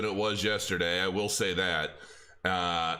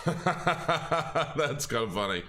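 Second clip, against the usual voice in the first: laughing at chat; 5 semitones higher, much slower than usual. Real, not acted. Reading a funny chat comment.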